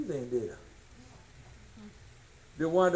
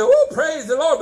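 high loud woo, praise the Lord; ecstatic whoop; 11 semitones higher, 11 dB louder than usual. Retold or imitated. Preacher imitating those who get tongues at once, ecstatic shout. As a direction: burst out in holy joy. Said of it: "I say".

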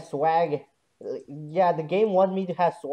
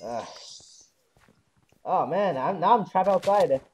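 usual speaking voice

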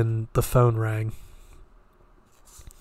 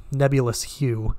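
usual speaking voice

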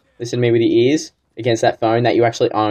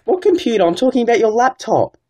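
much higher, fake baffled outrage; mock indignant; 9 semitones higher than usual. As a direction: play dumb, squeaking with outrage. Scammer feigns confusion, indignant.

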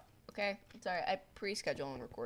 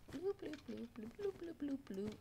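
much higher, softer playful bloops; silly bloop noises; 8 semitones higher, 5 dB quieter than usual. Real, not acted. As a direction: bubbly sound effect, fading down. Playful noise while idling.

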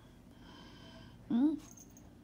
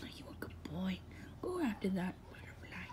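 whispering to a pet; 8 dB quieter than usual. Real, not acted.

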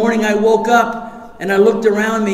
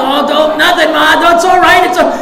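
nervous excuse; 6 semitones higher, 4 dB louder than usual. Retold or imitated. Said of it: "I go".

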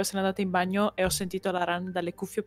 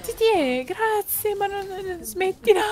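excited; 12 semitones higher, slower than usual. Real, not acted. Reacting to surprise donation.

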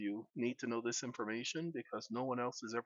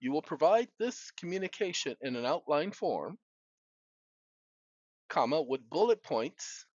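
dictating; 2 semitones higher than usual. Real, not acted. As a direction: speak each phrase for voice typing. Dictating to a phone.

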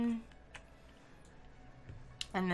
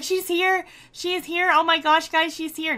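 high, loud mock squealing; excited squeal; 9 semitones higher, 8 dB louder than usual. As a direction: gush like starstruck fans. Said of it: "They're like".

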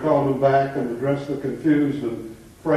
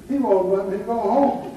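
higher-pitched, mock tearful little boy; tearful child; 5 semitones higher than usual. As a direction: complain like an upset child, lightly acted. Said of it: "said a tearful voice".

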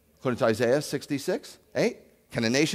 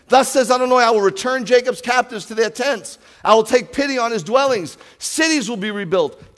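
higher, much louder proclaiming read; preaching scripture; 10 semitones higher, 9 dB louder than usual. Acted. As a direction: declare God's word to the hall. Reads scripture with force.

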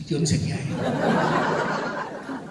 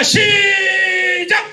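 high, loud, long start; drawn-out loud start call; 11 semitones higher, 8 dB louder, much slower than usual. Shown as a demonstration. Pastor starts clapping like generals for Kim Jong-un, drawn-out loud call. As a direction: stretch out the call. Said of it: "막 이렇게 치고 자".

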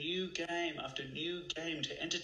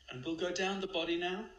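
calm guide voice, slightly raised; guided meditation; 4 semitones higher than usual. Acted. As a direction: coax them to breathe in.

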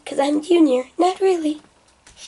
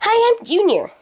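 put-on high, chirpy made-up kid voice; put-on Junior voice; 9 semitones higher than usual. Junior introduces himself in his put-on high voice. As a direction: pitch it way up as a new character. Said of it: "That's not your real voice".

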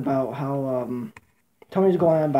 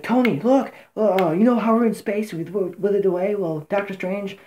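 higher-pitched, lightly voiced eager kid; excited Spider-Man; 4 semitones higher than usual. Spider-Man explaining his return to Tony, excited. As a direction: ramble up high like the young hero catching up his mentor. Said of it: "Spider-Man's like".

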